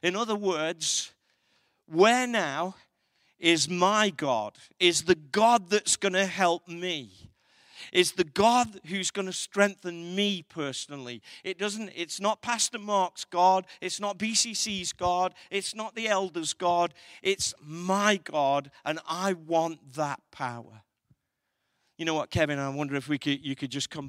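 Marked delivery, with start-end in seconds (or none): 1.9-11.2 louder, building personal plea; preacher making it personal, loud and building; impassioned preaching. Acted. 11.4-20.8 louder, punchy preaching; preacher claiming God as his own, fervent. Acted. Preaching climax continues.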